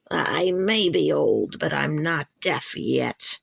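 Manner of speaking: put-on slow, low voice of an old she-cat spitting back. A narrator's reading. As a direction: spit it out like a proud old cat. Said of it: "Yellowfang spat in reply".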